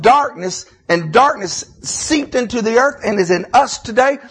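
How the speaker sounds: loud, high Southern pulpit preaching; full-force sermon delivery; impassioned preaching. Real, not acted. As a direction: preach it out high and hard, leaning on key words.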